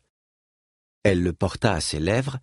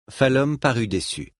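usual speaking voice

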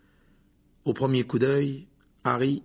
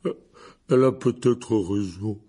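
a weary tipsy giant grumbling; drunk gloomy giant; 5 dB louder than usual. A narrator's reading. Hagrid figure, drunk.

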